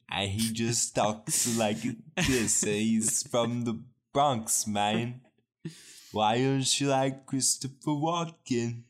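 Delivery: put-on slow, loud Bronx accent; hammy New York tough guy; Brooklyn accent. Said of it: "a Brooklyn".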